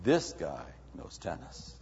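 soft, slow, low admiring murmur; hushed awe at the expert; impressed onlooker. Acted. Onlooker quietly impressed by the well-equipped player. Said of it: "you go".

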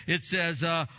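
ordinary talk

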